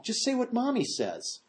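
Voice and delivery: put-on high, soft little-girl voice. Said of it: "about the little girl".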